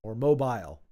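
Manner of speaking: Mobile rhyming its end with "mile"; Mobile as mo-BILE. Shown as a demonstration. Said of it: "am I saying that right?".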